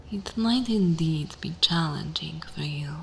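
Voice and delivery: normal talking voice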